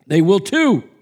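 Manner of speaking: obstinate. Acted. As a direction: dig in and refuse. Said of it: "His response was obstinate".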